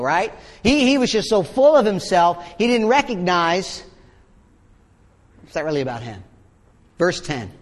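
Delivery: ordinary talk, nothing marked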